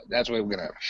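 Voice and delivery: ordinary talk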